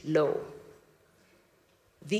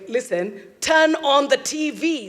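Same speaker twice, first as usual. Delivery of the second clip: urgent insistence; 5 semitones higher, 3 dB louder than usual. Retold or imitated. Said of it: "something in my voice".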